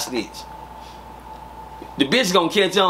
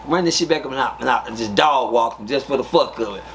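usual speaking voice

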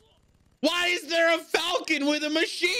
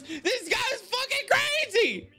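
excited shout; 10 semitones higher than usual. Real, not acted. Hyped reaction to anime fight.

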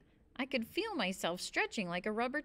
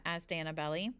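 plain reading voice

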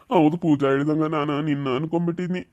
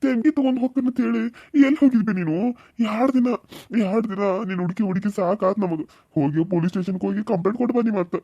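normal talking voice

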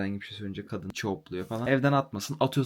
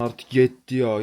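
retold yell; 2 semitones higher, 8 dB louder, slower than usual. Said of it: "Kadın böyle gene bağırmaya başladı".

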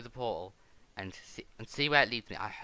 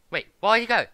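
loud, high-pitched bewilderment; real high yelp of confusion; startled shout; 12 semitones higher, 16 dB louder than usual. Real, not acted. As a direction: call out in sudden surprise as they vanish. Opponent vanishes during game fight.

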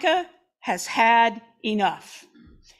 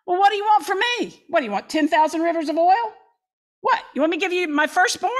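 fast, higher-pitched sarcastic comeback; defensive sarcasm; 5 semitones higher, faster than usual. Shown as a demonstration. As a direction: snap back defensively, rattling off the offers. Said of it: "humanity says something like".

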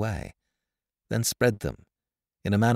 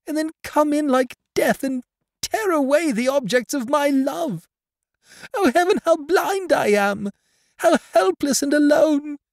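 put-on high, loud girl's lament; tortured anguish; 6 dB louder than usual. A narrator's reading. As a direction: pour out a heart near breaking. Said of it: "tortured, as it seemed, almost beyond endurance".

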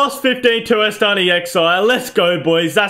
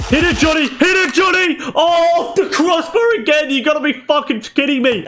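frustrated shout; 8 semitones higher than usual. Real, not acted. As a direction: scream as it hits the bar. Shot hits crossbar.

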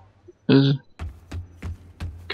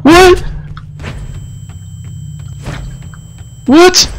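overwhelmed and losing it; frantic scared yelling; 13 semitones higher, faster than usual. Real, not acted. Overwhelmed by attacking enemies.

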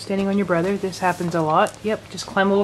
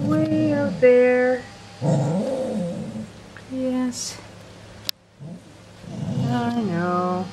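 cooing; 4 semitones higher, much slower than usual. Acted. Cooing to dog.

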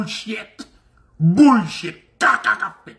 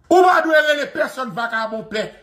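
ordinary delivery

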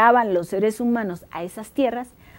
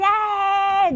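sharp high shout to silence everyone; shouted hey; 9 semitones higher, 4 dB louder than usual. Acted. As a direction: cut the noise with a yell. Cyclops yells to silence crowd. (grito para callarlos)

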